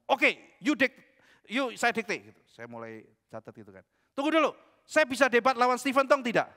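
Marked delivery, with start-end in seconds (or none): 0.1-2.3 plus aigu et plus fort, ton impérieux; homme arrogant imité. Retold or imitated. Pria sombong memerintah. 4.1-6.5 plus aigu et plus fort, provocateur; défi arrogant. Retold or imitated. Pria sombong menantang debat.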